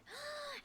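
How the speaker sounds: sharp delighted intake of breath; excited gasp. Real, not acted. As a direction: gasp with glee. Gasp at chat message.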